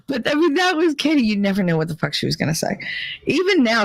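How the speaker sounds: ordinary talk, nothing marked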